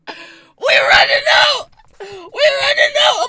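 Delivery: slow, high, wheezing laugh-shout; crying with laughter. Real, not acted. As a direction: laugh until it breaks into tears. Laughing so hard it cracks.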